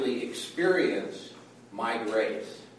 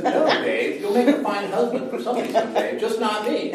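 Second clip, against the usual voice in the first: higher, quick, laughing mock rejection; Karen's brush-off; 8 semitones higher, 4 dB louder, faster than usual. Preacher voicing his wife turning him down, higher laughing mock refusal. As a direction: toss off a polite refusal with a laugh. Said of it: "and says".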